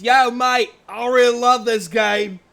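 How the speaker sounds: put-on loud, high Australian bot accent; hammed-up Aussie spam bot; over-the-top Australian voice; dingo accent. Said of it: "I have no idea what accent I gave him".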